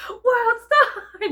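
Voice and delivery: high, loud, laughing yell; a laughing, imitated crowd yell; shouted World Star. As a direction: shout it like a bystander filming a fight. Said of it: "be like".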